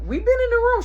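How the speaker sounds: high, loud innocent answer; kid excuse. Retold or imitated. Kid claiming they stayed in, high. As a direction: play innocent.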